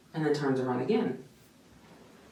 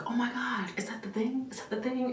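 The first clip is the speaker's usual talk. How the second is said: excited husband; 9 semitones higher, 4 dB quieter than usual. Retold or imitated. Husband sees baby on screen.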